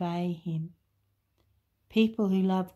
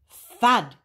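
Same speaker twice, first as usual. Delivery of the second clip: loud thud; 3 semitones higher, 10 dB louder than usual. Acted. Sound effect in story.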